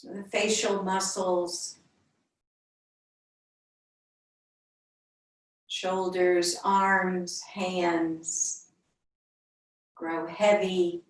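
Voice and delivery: unhurried meditation voice with long pauses between phrases; yoga teacher leading a calm body scan; guided meditation. Real, not acted. Leads a centering meditation.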